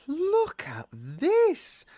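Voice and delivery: high, drawn-out mock rapture; in ecstasy. Malfoy ribbing Ron over his dress, in slow high ecstasy. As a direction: stretch it out, high-pitched with delight. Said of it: "said Malfoy in ecstasy".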